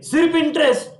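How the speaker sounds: high-pitched quiz prompt; drilling call-out. Shown as a demonstration. Teacher quizzing the class on interest entries, calling out. Calls out last quiz item.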